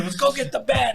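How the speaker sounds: high shouted command; mock shouted order. Dad yelling at his son to fetch the bat, shouting. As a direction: bark the order at the kid.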